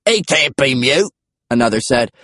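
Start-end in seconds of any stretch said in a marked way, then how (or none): 0.1-1.1 slow, higher British dinosaur voice; British-accented creature, pitched up. A narrator's reading. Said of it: "British accents were unexpected".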